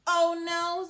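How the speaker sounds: loud, high mock alarm. Parker seeing the wolf fall, loud mock alarm. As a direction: cry out as if startled. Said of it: "said Parker".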